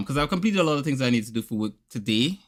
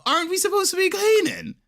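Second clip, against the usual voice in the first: very high, incredulous scolding; hammed-up fed-up dad; mock exasperation. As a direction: reproach him, voice pitched way up.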